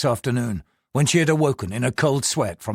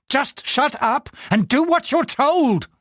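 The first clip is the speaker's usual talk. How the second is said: higher, slow furious command; furious order; 12 semitones higher, slower than usual. Acted. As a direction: lay down the law, raging. Husband in rage.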